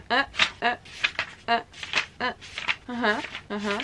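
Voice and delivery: short quick "e" sounds, page after page; rapid mock reading noises; reading fast sounds. Shown as a demonstration. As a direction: mimic racing through a book. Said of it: "Kitabı böyle okuyorum. Bakın şimdi".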